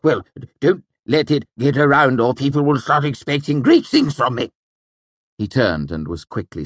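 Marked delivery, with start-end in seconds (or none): 0.0-4.5 high excited nobleman; bright, laughing older count; Count Threep voice. A narrator's reading. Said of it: "trying not to look pleased".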